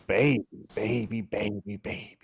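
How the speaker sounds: ordinary talk, nothing marked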